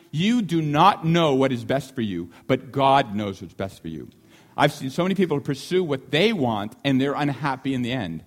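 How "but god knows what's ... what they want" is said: usual speaking voice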